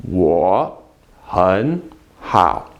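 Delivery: low, word-by-word "Wo hen hao" with pauses; slow model phrase. Shown as a demonstration. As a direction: lead learners slowly through each word. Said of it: "Say that with me".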